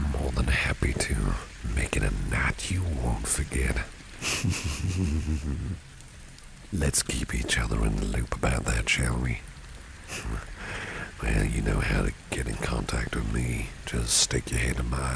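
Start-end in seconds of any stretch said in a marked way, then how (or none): 3.8-5.8 higher-pitched rolling chuckle; suggestive chuckle. Acted. Boss promises employee an unforgettable night, suggestive chuckle. 9.4-11.2 very soft, brief laugh; quiet chuckle. Acted. Boss asks employee to keep in touch, quiet chuckle.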